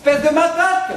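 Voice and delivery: high, loud furious insult; angry insult. Acted. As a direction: hurl the insult with contempt. Insulting the absent suitor.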